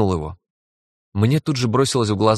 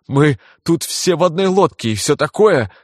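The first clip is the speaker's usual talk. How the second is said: shaking with laughter; 5 semitones higher, 3 dB louder than usual. Acted. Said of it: "Он затрясся от смеха".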